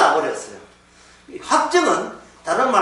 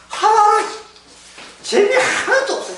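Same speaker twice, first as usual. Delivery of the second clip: bored retiree whine; 14 semitones higher than usual. Retold or imitated. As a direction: play the fed-up retiree, hamming it. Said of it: "은퇴하시고 난 뒤에".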